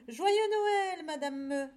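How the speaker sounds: high, loud greeting easing down at the end; joyful exclaiming. A narrator's reading. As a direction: call out a festive wish as a lamb. Lamb wishes cow merry Christmas. (joyeuse exclamation d'agneau)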